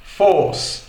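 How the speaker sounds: "force" with no R; British force. Shown as a demonstration. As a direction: drop the R in "force". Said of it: "no inglês britânico é force". ("force" sem R)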